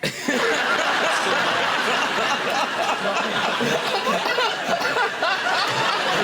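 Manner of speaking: long laughing fit; long laughter. Real, not acted. Guest laughing at Secret Service tale, long laugh. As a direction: roll with laughter.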